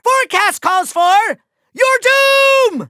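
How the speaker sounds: high, slow villain shout; menacing proclamation. Acted. Villain announcing foe's weather forecast, menacing shout. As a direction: build to a long cry on doom. Villain threatens his foe.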